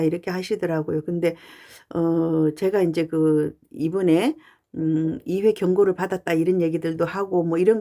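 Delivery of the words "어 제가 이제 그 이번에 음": normal talking voice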